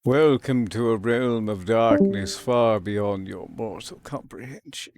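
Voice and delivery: slow booming dark-lord welcome; eldritch horror. Acted. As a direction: intone it like an ancient evil. Game lobby, dramatic villain intro.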